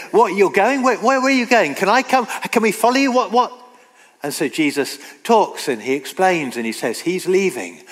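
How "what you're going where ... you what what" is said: raised, eager questions tumbling out; a lightly voiced Peter pleading to follow; anxious Peter. Preacher voicing Peter begging to come along, flustered and raised. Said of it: "Peter then".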